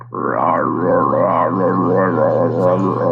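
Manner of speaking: low slow croaking rah; frog creature voice. Acted. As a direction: croak it slowly like a beast. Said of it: "just looks at you, just like".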